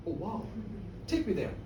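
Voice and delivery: soft, low wonder; hushed awe. Acted. Toby asks Peggy to take him there, hushed. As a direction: breathe it quietly, quite won over. Man awed by fairy wishing place.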